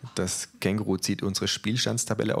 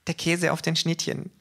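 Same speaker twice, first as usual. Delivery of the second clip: sweetly innocent, higher offer; feigned innocence in the kangaroo voice; 10 semitones higher than usual. A narrator's reading. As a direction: ask as if nothing was meant. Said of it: "mit Unschuldsmiene".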